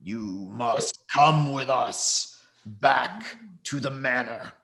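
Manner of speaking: slow, solemn character voice; slit-throated man. Acted. Slit-throated man telling them to come along, slow and solemn. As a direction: draw the words out with grave weight.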